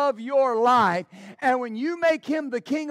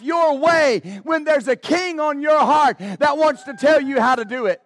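building louder, passionate sermon delivery; preaching at full force; 4 semitones higher, 6 dB louder than usual. Acted. Pastor preaching to his church, full-force sermon. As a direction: drive it home to the congregation.

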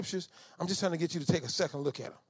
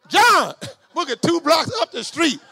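shouted call, high and louder, slow, with a laugh; 9 semitones higher, 9 dB louder, slower than usual. Retold or imitated. Preacher shouting for his cousin who fled, laughing yell.

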